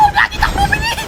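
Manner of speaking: high, urgent shout to run; frantic gamer yelling run; panicked shout. Real, not acted. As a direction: yell at yourself to flee. Panicked fleeing in game.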